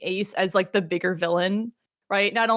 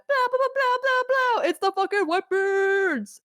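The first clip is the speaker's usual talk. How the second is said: very high, sing-song blah blah; high, taunting mock voice; mock dramatic reveal; 11 semitones higher than usual. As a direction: squeal it like a cartoon announcer. Mocking reveal.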